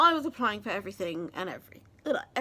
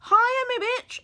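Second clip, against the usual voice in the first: loud, high-pitched brash greeting; cheeky hail; 12 semitones higher, 8 dB louder, slower than usual. Acted. Cheeky greeting, hailing the casting.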